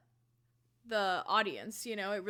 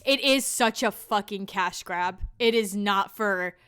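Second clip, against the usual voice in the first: normal talking voice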